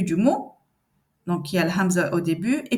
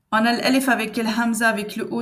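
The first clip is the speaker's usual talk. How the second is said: ordinary delivery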